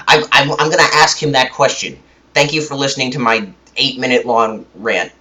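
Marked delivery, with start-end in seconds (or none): none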